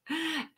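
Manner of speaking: quick breathy giggle; a little laugh to herself; soft laugh. Real, not acted. Live seller giggling to her viewers.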